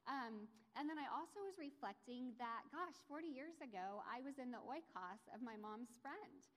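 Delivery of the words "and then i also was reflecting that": ordinary talk, nothing marked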